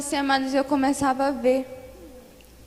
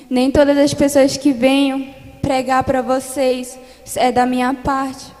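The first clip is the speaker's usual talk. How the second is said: fervent preacher's testimony, pushing louder as it builds; preaching testimony; 5 dB louder than usual. Acted. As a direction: preach the vision to the congregation with rising force. Testimony preached with growing force. (testemunho pregado com fervor crescente)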